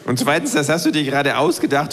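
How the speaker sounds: ordinary delivery, nothing marked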